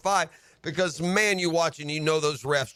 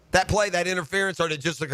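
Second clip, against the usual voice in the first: usual speaking voice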